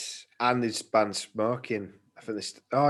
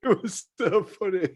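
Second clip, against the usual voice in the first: said through real laughter, higher; laughing; 7 semitones higher than usual. Real, not acted. Recalling the stag night to his friend, laughing. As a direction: laugh the words out.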